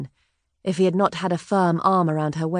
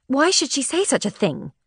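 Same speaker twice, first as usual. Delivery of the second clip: higher, insistent challenge; sharp demand; 8 semitones higher than usual. A narrator's reading. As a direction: press him hard for an answer. Elizabeth alarmed, pressing Tom.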